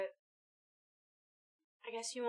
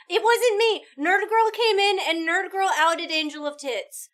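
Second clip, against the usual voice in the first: loud protest; 7 semitones higher, 13 dB louder than usual. Real, not acted. Protesting innocence, laughing.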